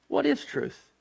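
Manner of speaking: asked high and sharp, pitch jumping up on "What is truth?"; "What is truth?" thrown up high as a challenge; Pilate's question. Acted. Preacher voicing Pilate's scornful question to Jesus, high. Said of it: "Pilate asked the question".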